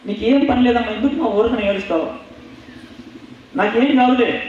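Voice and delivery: put-on high, brushing-off voice of a careless boy; dismissive son. Boy telling his weeping mother to stop, high and dismissive. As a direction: wave her worries away, pitched high.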